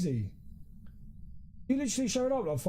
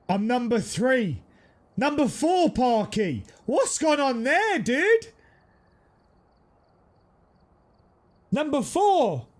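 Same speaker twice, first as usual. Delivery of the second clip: excited shouting; 6 semitones higher, 6 dB louder, slower than usual. Real, not acted. Excited yelling over game ranking.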